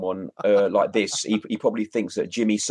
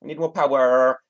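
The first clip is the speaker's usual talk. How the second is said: louder, higher put-on accent, R rolled; hammed-up imitation of his accent; mimicking his accent for laughs; mock accent; 5 semitones higher, 4 dB louder than usual. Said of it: "I love his accent".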